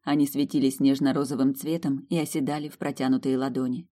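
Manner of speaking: ordinary delivery, nothing marked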